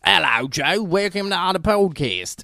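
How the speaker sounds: slowed, high put-on greeting; mock Aussie host. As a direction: ham up the excited little Australian. Parodying the midget voice.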